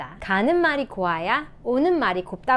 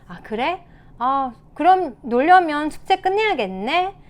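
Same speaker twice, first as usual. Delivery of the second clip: light, unbothered parent, rising higher; easygoing parent; 5 semitones higher, 3 dB louder than usual. Shown as a demonstration. Parent answering homework refusal, easygoing demonstration. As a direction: model a parent handing responsibility back. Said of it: "다음에는 한번 이렇게 말씀해보세요".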